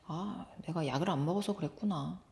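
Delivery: soft, low inner musing; quiet realization. Acted. Boy watching his brother leave, quietly realizing. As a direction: say it softly to yourself as it sinks in. Boy thinking to himself, accepting it.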